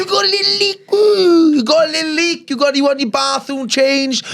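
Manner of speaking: high, shouty radio-ad pitch; hammed-up ad read. Podcast host selling a plumbing sponsor, hammed-up ad shout. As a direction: belt out the sponsor's name, stretching it out.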